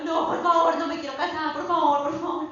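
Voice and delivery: loud, hammed-up begging; mock pleading. Bride imagining Jesus at the altar, loud mock begging. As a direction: beg to get out of it. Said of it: "yo me imagino a Jesús ahí esperándote y uno".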